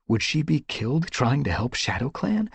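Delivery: ordinary delivery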